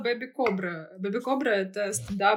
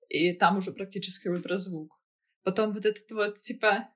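usual speaking voice

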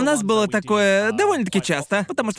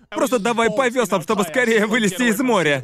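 ordinary talk, nothing marked

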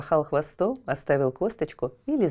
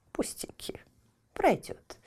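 put-on old woman whispering; 9 semitones higher, 6 dB quieter, slower than usual.

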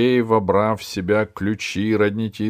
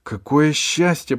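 joyful thought; 8 semitones higher, 5 dB louder than usual. A narrator's reading. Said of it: "подумала Алиса".

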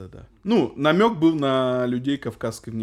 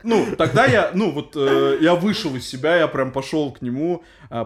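normal talking voice

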